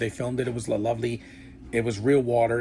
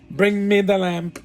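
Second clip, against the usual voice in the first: mock villain line, high, loud and slow; remake Jafar; 8 semitones higher, 6 dB louder, slower than usual. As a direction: draw it out loudly, mocking a weak villain. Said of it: "In the remake is like".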